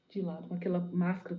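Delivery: plain reading voice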